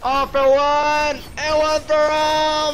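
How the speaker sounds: high, slow, booming musketeer shout; rallying battle cry. Pretend. Streamer rallying teammates with a booming battle cry. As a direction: roar it to rally the team.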